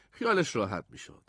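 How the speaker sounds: plain reading voice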